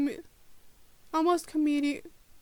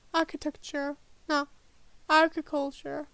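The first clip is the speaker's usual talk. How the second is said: ordinary talk, nothing marked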